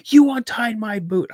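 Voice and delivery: high, loud accusation; acted outraged yell; retold flipping out. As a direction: burst out accusingly at dad. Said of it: "I'm, like, flipping out on him".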